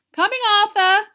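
high, loud sing-out call. A narrator's reading. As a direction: call to a husband in another room. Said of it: "called Missus Weasley".

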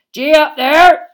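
huge loud roar. A narrator's reading. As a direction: urge the beasts on. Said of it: "he roared".

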